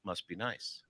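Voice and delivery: low and soft, must be nice; soft low take. Shown as a demonstration. Coach demos a soft low must be nice. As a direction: let "nice" drop to near a murmur. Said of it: "Here's my voice coaching".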